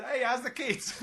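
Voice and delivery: high, loud teasing voice, laughing; mock innocent chirp. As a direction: ask sweetly while laughing. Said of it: "I said".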